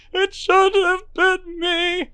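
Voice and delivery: slow, high broken sob; grieving man. Acted. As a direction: let it stretch out like a wail.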